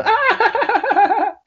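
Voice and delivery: high hooting laugh of the lads; mocking laughter. Friends laughing at his voice message, high mocking laughter. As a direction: cackle at him like the boys did. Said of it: "a voice message of him and all the boys going".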